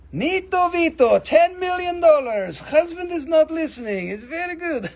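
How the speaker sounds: high put-on voice, hammed up; mock character voice. Seller joking to viewers about her husband, in a high hammed-up voice. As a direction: pitch it high like a silly caricature.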